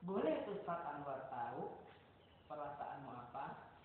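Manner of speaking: soft polite asking. Shown as a demonstration. Ustaz asks a child's permission: soft polite question. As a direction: ask it quietly, asking leave. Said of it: "maka izin".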